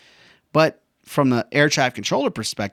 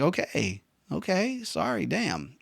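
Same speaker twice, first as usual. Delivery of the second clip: small, softer voice of a scolded student; acted-out sheepish reply; meek apology; 6 semitones higher, 7 dB quieter, slower than usual. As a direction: back down quietly, a bit put out. Student's meek reply to being yelled at.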